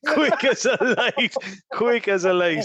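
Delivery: laughing through the words, high. Real, not acted. Friend repeating the punchline, laughing.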